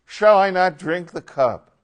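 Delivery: loud, high, emphatic; resolute declaration. Acted. Jesus finally working through to obedience, resolute and loud. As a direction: ring it out as a firm decision.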